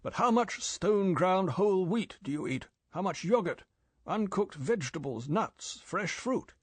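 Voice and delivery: mildly impatient interrogation. A narrator's reading. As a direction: fire the questions impatiently. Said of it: "said M impatiently".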